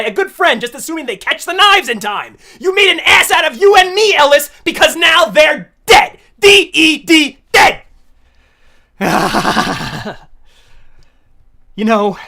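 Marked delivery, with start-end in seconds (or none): 2.6-7.8 loud, higher scolding the character; scolding shout. Real, not acted. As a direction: yell the blame at Ellis. Yelling at character Ellis.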